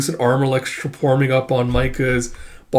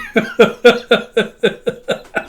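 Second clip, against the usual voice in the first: real laugh; 9 semitones higher than usual. Real, not acted. Laughing during the story.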